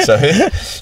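squealing laugh; high laughter. Real, not acted. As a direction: laugh high at your own joke. Laughing at own joke. (risa aguda real)

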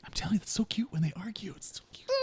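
gushing whisper. Real, not acted. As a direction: whisper it adoringly. Cooing about gnomes arguing.

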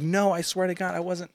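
high-pitched, protesting mock yell; retold screaming back. Boyfriend defending himself to his girlfriend, mock screaming back. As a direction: plead innocence in a half-scream, retold. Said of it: "I'm screaming back at her".

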